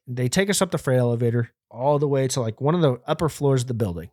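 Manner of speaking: plain reading voice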